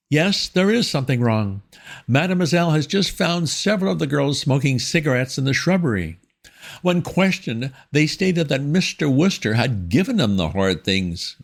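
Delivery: high, prim schoolmistress tone; stern headmistress voice. A narrator's reading. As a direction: speak down to him like a severe headmistress. Miss Tomlinson voiced, stirred.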